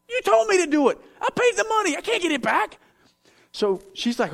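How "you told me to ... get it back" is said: high, whiny protesting voice; defensive protest. Retold or imitated. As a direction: squeak out a hurt, defensive excuse.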